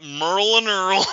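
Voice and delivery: "Merle and Earl" run together so Daryl loses its D; "Merle and Earl" slurred into rhyming mush; mimicked slurred names. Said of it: "the way he said Merle and Daryl".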